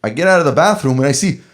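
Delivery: normal talking voice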